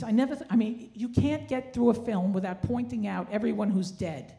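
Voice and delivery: ordinary talk, nothing marked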